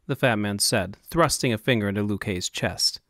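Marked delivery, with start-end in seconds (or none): none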